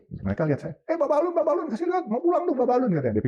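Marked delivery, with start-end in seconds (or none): 0.9-2.9 very high-pitched retold call; crowd calling out. Someone in the crowd tells others to let him through, calling out.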